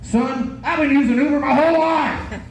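put-on high indignant old-man shout; old man yelling. Thousand-year-old passenger scolding his driver. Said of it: "he goes".

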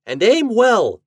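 high, loud captain's call; cried command. A narrator's reading. Captain to his snowball fighters, crying out loud. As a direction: shout the order to the troops. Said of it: "cried Captain Reddy".

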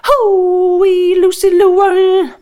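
high, loud whooping call drawn out slowly; shout. Acted. Father shouts to his daughter from the sunroof, loud high whoop. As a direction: holler it from the truck roof. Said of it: "shout out in the air".

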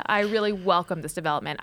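ordinary talk

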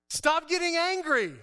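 slow, high, loud stern rebuke; Holy Spirit's voice. Pretend. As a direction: tell him firmly to stop.

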